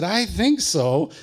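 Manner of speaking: slow, high, dazed answer; dazed reply. Retold or imitated. Dazed boy answers Jerry slowly after hitting his head. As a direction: say it slowly, still seeing stars. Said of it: "And I said".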